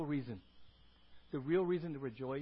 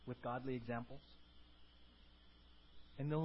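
low, subdued, halting prayer; humble, quiet address to God; congregational prayer; 4 semitones lower, 4 dB quieter than usual. Real, not acted. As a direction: pray earnestly and softly, pausing between phrases. Leads closing prayer.

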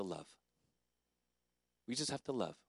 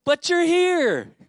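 loud, high, drawn-out holler; acted affirming yell, stretched out; shouted reassurance; 14 dB louder, much slower than usual. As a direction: slowly shout it so a deaf friend hears. His shouted answer to Thelma.

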